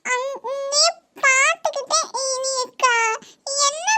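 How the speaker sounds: put-on high, slow, tearful child's whine; a little girl sobbing for her uncle's attention; whiny child crying. Niece cries to her uncle to look at her.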